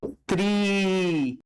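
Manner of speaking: त्रि stretched out very slowly, each sound drawn long; slow syllable demo. Shown as a demonstration. Teacher spelling out त्रि for students, very slow. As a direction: drag out त्रि slowly so every sound is heard. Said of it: "वैसने है त्रिशूल".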